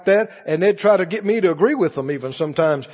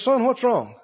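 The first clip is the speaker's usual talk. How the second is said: dad's question; 3 semitones higher than usual. Retold or imitated. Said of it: "my dad comes by and he says".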